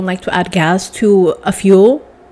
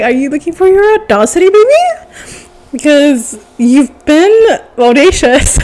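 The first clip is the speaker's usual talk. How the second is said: sassy mock sweetness; 6 semitones higher, 5 dB louder than usual. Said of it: "I don't know you say it like that".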